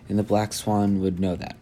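ordinary delivery, nothing marked

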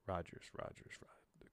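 whispered musing repeat; muttered. Acted. Puzzling over a name while reading, whispered. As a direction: murmur the name to yourself.

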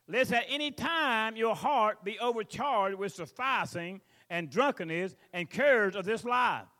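loud, slow fiery preaching; preaching scripture. Acted. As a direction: call it out slowly, full voice. Preacher reads scripture at full force.

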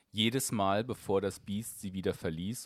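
plain reading voice

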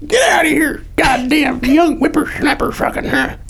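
put-on high, slow cranky old geezer; old prospector. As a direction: grumble at the young whippersnapper.

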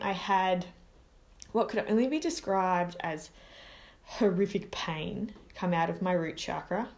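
plain reading voice

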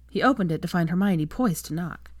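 ordinary delivery

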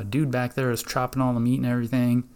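normal talking voice

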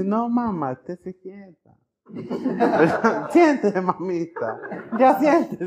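high and giggling, telling mom to sit; laughing Lord. Acted. The Lord telling the mother to sit down, laughing. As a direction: laugh through it while shushing a fussy mother.

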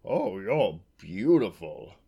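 slow, drawn-out wonder; awestruck. A narrator's reading. Farmer to the unicorn he has just seen, awestruck. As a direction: marvel slowly at a beautiful sight. Man first sees the unicorn.

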